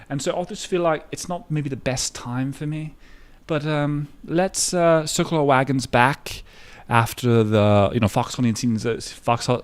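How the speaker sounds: mock posh British gent cancelling plans, light British accent. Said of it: "It's in a, in a British accent".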